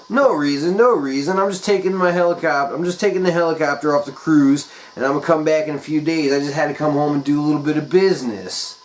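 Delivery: high, quick breezy excuse; evasive rich guy. Retold or imitated. Jim dodging the sitter's question, high and quick. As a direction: brush it off fast.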